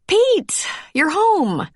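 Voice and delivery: startled that he picked up the phone; surprised. Acted. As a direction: caught off guard, voice jumps up. Startled when he answers phone.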